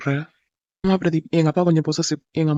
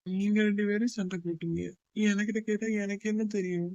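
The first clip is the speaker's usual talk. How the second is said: much softer, slightly raised pitch; quiet, hapless protest; muttered aside; 3 semitones higher, 11 dB quieter than usual. Acted. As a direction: grumble it under your breath. Cowed aside after father's question.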